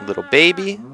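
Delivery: high cooing. Real, not acted. Baby cow, high.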